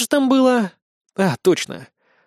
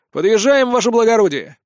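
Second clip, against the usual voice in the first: raised, louder heads-up; higher-pitched called warning; calling out warning; 6 semitones higher, 5 dB louder than usual. A narrator's reading. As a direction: announce it briskly to the boss. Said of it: "предупредил Санёк".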